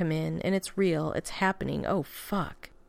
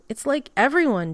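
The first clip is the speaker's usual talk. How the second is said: higher and louder, words tumbling out in a panic; panicked outburst; 7 semitones higher, 6 dB louder than usual. A narrator's reading. Danny panicking to Steve before the wedding, higher and louder outburst. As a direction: blurt it out, nerves fraying.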